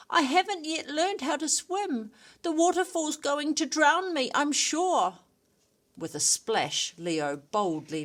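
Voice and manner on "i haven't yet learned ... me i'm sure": high, frantic pleading; words tumbling out in fear of the waterfall; panicked. Acted. Drowning cheetah begs Leo, high and panicked. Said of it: "cried the cheetah in panic".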